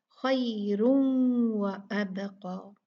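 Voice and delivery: खैरुन व अबका slow, tanween merged into the wao; slow Quranic recitation, nasal merge into wao; idgham recitation. Shown as a demonstration. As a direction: blend खैरुन into व without a break. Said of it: "उसको वाव से मिला देंगे".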